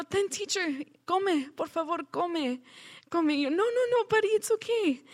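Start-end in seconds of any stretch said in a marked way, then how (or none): 0.0-3.3 put-on higher, gentle childlike urging; child urging. Grateful boy pushes his food on teacher, childlike urging. 3.5-5.0 much higher, flustered protest; flustered refusal. Retold or imitated. Teacher declines the boy's food, high flustered protest.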